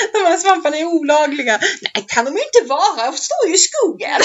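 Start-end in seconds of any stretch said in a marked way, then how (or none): 1.8-4.2 high, giggly retold reply; laughing quote. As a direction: laugh through the quoted answer. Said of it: "retelling quote while laughing".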